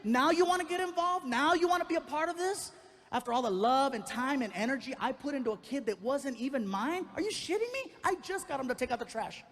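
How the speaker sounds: furious high shout at the screen; high-pitched ranting yell; screaming. Retold or imitated. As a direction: yell it out, outraged and betrayed. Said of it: "I'm screaming again".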